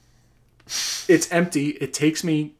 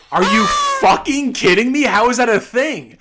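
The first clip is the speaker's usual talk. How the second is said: incredulous shout; 9 semitones higher, 11 dB louder than usual. Retold or imitated. Retold outrage at the clerk.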